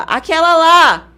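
loud, high, slow call-out; demonstrated corner call. Shown as a demonstration. Showing the secret code shouted from the corner, loud drawn-out call. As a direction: holler a coded cue to a fighter. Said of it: "já vou falar".

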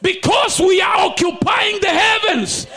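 shouted, high prophetic preaching; fiery declaration. Acted. Preacher declaring blessings over the congregation. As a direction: declare it over the crowd with full force.

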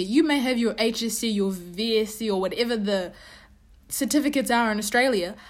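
usual speaking voice

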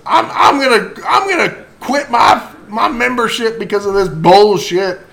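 high, put-on Georgia accent; an upset club member, hammed up in Georgia dialect. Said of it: "that's my Georgia accent".

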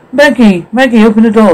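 high, urgent call through the door. Acted. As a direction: call her to open up, pitched high. Pleading at a locked door.

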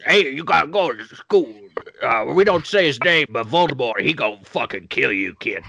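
lively ghoul talking round ice cream; movie ghoul voice with a mouthful; Beetlejuice. Acted. Improv player warning about Voldemort, mouthful ghoul impression. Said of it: "do Beetlejuice while eating ice cream".

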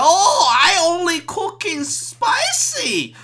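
high, slow mock Cantonese accent; Cantonese-accented owner. Restaurant owner answering Shane, exaggerated Cantonese accent. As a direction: draw it out in a hammed-up Cantonese accent. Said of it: "that's a Cantonese accent".

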